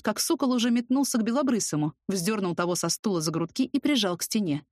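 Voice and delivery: ordinary delivery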